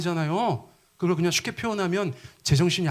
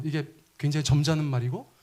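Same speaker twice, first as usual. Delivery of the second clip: ordinary talk, nothing marked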